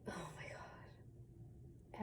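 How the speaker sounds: slow, very soft whisper; whispered dismay. Retold or imitated. Recruit seeing no one writes, whispered dismay. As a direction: whisper it slowly in retold dismay. Retold dismay at getting no letters.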